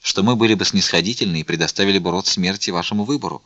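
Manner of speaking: ordinary delivery, nothing marked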